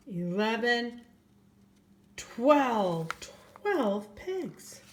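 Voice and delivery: gentle storybook reading voice; reading to children. A narrator's reading. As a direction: read it like bedtime story time for little ones. Reads a picture book aloud.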